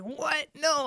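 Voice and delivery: imitated mom, high-pitched disbelief; mom losing it. Upset mom answering her joking daughter, imitated outrage. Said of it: "she about lost it".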